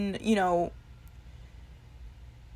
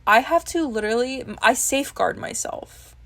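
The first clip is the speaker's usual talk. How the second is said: ordinary delivery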